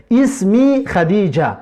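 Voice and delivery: اسمی خدیجه said slowly, syllable by syllable; teacher reads Khadija's line slowly; slow Arabic demo. Shown as a demonstration. Teacher models Khadija's greeting for the class, slow and clear. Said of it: "نام من خدیجه".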